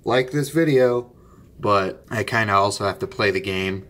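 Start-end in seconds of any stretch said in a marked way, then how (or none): 0.0-1.1 high-pitched mock plea for likes; pushy video host. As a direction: imitate those like-begging video guys, pitched up. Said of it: "like one of those guys".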